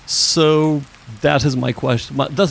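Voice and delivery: normal talking voice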